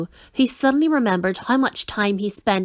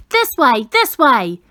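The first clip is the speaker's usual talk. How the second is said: louder guiding call; 3 semitones higher, 5 dB louder than usual. A narrator's reading. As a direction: call them over to your side. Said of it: "the voice called again".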